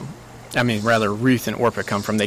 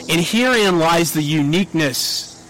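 ordinary delivery

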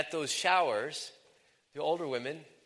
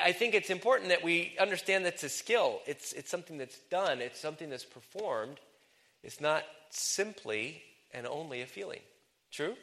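usual speaking voice

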